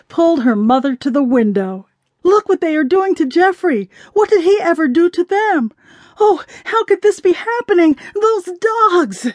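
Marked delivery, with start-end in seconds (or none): 2.2-9.3 high, distraught outcry; hysterical outcry. A narrator's reading. Jamila to her mother at the window, hysterical outcry. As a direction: cry out in horrified outrage, pitched high.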